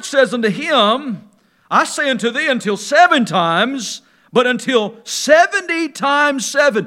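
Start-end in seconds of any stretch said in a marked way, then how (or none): 1.7-6.9 rising to a shout on "seventy times seven"; emphatic preaching. Acted. The Lord answers Peter, preaching.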